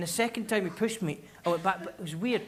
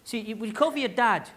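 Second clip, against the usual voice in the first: ordinary talk, nothing marked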